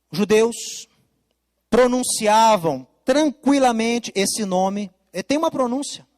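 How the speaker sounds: ordinary delivery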